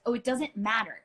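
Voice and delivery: ordinary delivery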